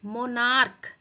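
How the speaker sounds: मोनार्क said loud, ending in K; hard K ending. Shown as a demonstration. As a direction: stretch मोनार्क and close it on a hard K. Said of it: "इसे मोनार्च नहीं बोलेंगे".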